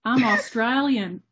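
slow, amateur Aussie impersonation accent; Australian accent. Shown as a demonstration. Guest testing her Australian accent on the host. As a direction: try a drawn-out Australian accent. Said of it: "Can you do an Australian accent".

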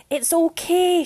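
high, loud yell down to the water; calling down from the platform. Acted. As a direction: shout it to reassure her friend. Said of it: "she yelled".

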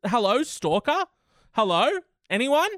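high, rising mock calls; mock calling out. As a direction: call out into the void, mock-alarmed.

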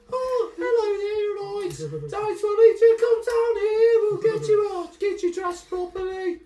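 put-on very high, loud sing-song Soonian accent. Dresser greeting the newcomer in a high Soonian accent. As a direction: trill it in a shrill local Soonian accent. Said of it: "Soon has a certain accent".

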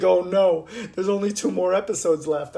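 hammed-up despair about few episodes left; mock dismay. Only two episodes remain.

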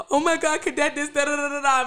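mock crying. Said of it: "I'm just crying".

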